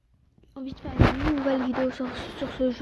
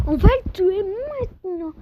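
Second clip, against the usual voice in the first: angry; 6 semitones higher, 7 dB louder than usual. Real, not acted. Angry at enemy in game.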